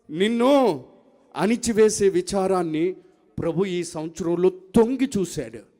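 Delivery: loud, measured declarations in separate phrases; forceful preaching. Real, not acted. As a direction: proclaim each phrase with rising force. (గట్టిగా ప్రకటిస్తూ ప్రసంగం)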